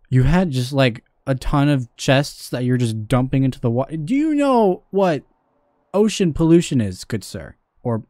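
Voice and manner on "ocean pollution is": ordinary delivery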